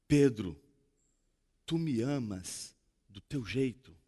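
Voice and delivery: Jesus asking softly, accepting Peter's love; gentle question. Acted. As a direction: ask it gently, almost a hush. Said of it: "Jesus olha pra Pedro e diz assim".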